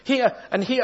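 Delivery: high, distressed wail. Acted. Corrie, pointing out fleas to Betsy, wails high and distressed. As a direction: wail as you point at each flea. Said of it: "I wailed".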